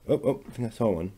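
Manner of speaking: ordinary talk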